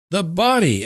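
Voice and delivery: urgent cry. A narrator's reading. Lois insists on the corpse.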